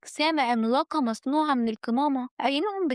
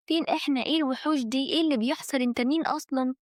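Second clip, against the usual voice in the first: plain reading voice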